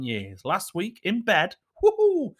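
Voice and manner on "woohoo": high, loud woohoo; cheeky cheer; mock cheer. Real, not acted.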